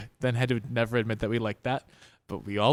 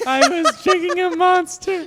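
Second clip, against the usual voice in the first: laughing; 7 dB louder, slower than usual. Real, not acted. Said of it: "a Monster voice for my commercial".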